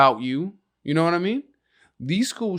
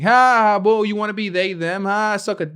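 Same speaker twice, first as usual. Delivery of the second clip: higher, louder schoolyard jeer; taunting bullies; 6 semitones higher, 6 dB louder than usual. Retold or imitated. Kids at school taunting the son with a jeer. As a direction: sneer like a mock bully. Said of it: "people saying".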